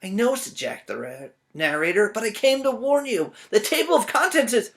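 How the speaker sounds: high, loud urgent warning; urgent Jack. Acted. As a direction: rush to warn the birds. Jack warns.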